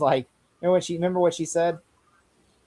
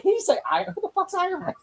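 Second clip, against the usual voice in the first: high, squeaky confused squeal; baffled disbelief; 3 dB quieter than usual. Retold or imitated. Friend recalling first viewing, squealing in baffled disbelief. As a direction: play it like you can't believe the name. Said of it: "first time I was like".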